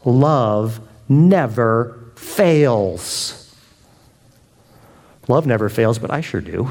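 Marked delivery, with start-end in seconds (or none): 0.1-3.5 drawn out very slowly, each word weighed; slow solemn reading. Acted. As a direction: let love never fails hang in the air. Slow reading of scripture line.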